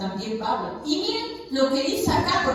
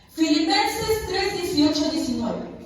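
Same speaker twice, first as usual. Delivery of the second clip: announcing the verse; 4 semitones higher than usual. Real, not acted. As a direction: call the chapter out so the whole room hears. Pastor announces Bible verse.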